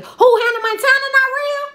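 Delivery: high, loud outraged squeak; shocked disbelief. Real, not acted. To a commenter doubting Hannah, high shocked disbelief. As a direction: act stunned anyone could say that. Answering a fan's claim.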